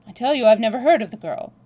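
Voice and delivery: agitated, insistent outburst; heated denial. A narrator's reading. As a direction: deny it forcefully in a rush. Acted quarrel, insistent denial.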